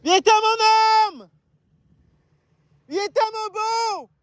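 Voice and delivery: long high hail to a lost dog; calling out. Real, not acted. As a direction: call the lost dog from far off. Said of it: "ça fait une heure que je crie".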